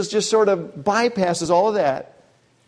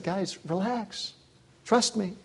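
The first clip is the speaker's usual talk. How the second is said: much softer, slow and soothing; calm reassurance; 3 semitones higher, 8 dB quieter, slower than usual. Retold or imitated. Jesus calming frightened disciples after the storm, softly reassuring. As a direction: settle them gently, no hurry. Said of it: "and just said".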